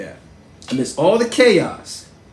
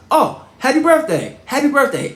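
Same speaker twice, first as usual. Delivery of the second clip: lightly voiced cheerful greeting, higher; President greeting; 10 semitones higher, 3 dB louder than usual. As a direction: beam a quick happy birthday back. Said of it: "he looks right at me and says".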